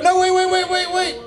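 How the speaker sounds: high-pitched no wait wait; sudden squeaky panic; frantic high stop. Real, not acted. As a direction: leap way up to halt the intro. Host stops his own introduction.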